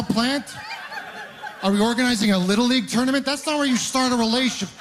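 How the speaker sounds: rant, raising the pitch in mock outrage; indignant rant. Real, not acted. Indignant rant about first date.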